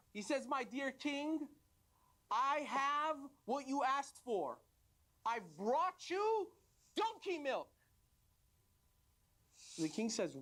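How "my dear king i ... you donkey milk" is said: very slow, high-pitched ceremonial announcement; grand proclamation. Acted. Traveler presenting the milk to the king, grand proclamation. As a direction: stretch each phrase out grandly.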